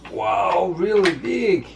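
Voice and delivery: high, amazed, playful; excited. Pretend. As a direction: gush over it like it's huge. Dad reacting to child's toy.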